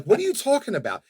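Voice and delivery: high, stunned disbelief; incredulous. Retold or imitated. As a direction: retell your shock, pitch jumping up. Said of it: "And I'm like".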